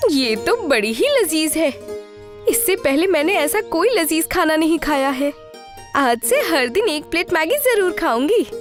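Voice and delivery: high-pitched, thrilled villager; delighted woman customer. Acted. Village woman loving her first plate of Maggi, high and delighted. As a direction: gush over tasty food.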